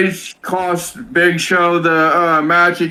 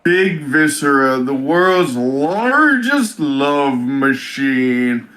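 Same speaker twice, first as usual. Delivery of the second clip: low, drawn-out wrestler voice; announced love machine; 5 semitones lower, slower than usual. Retold or imitated. Podcaster re-enacting Viscera's entrance for his co-host, low slow boast. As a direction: slowly draw out the boast, pitched down. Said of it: "all you hear is".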